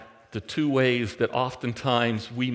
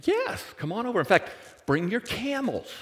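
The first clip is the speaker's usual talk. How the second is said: high, bright eager welcome; eager invite; 9 semitones higher than usual. Retold or imitated. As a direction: gush out a happy yes. Rebecca eagerly invites guests.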